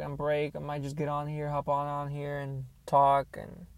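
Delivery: usual speaking voice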